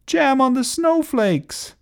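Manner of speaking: very high mock-panicked cry; mild scream. A narrator's reading. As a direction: squeal it high without much volume. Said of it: "screamed Augustus".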